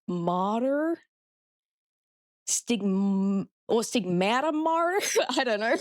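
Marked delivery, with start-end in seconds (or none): 0.1-3.5 testing an American r in Martyr; tentative American accent try. Shown as a demonstration. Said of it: "I don't know how to do an American accent". 3.7-5.1 Stigmata Martyr with a rolled-in American r, questioning; tentative American accent try. Shown as a demonstration. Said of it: "I don't know how to do an American accent".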